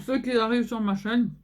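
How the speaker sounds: ordinary talk, nothing marked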